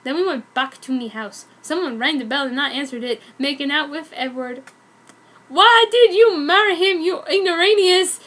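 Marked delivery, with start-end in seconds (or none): 5.5-8.2 high, loud accusing yell; angry shout. Acted. Guy at the door yells at the newlyweds, angry shout. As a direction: yell it at the couple in the doorway. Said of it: "shouted the guy at the door".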